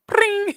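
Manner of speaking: high bright pring up top; quick high pring noise; imitated sound effect. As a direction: sing out the show's sound cue. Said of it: "Is that the sound?".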